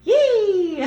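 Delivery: very high, loud gleeful yelp with a laugh; bright little shriek of joy; happy squeal. Shown as a demonstration. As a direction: squeal like something great just happened. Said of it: "listen to the tone in your voice".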